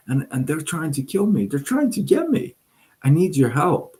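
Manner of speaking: high-pitched, frantic mouse plea; panicked pleading. Acted. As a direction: voice jumps up in acted panic. Mouse begs cow for its life.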